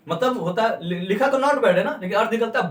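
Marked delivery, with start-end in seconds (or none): 1.4-1.7 high, loud appreciative not bad; impressed praise. Shown as a demonstration. Teacher shows praising clothes with not bad. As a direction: compliment a friend's outfit. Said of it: "ये word बोलो आप".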